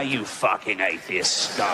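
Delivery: low, slow, bored demon voice; lazy devil growl. Acted. Devil sneering at the atheist, low slow bored growl. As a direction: drop deep and drag it out, half-bored.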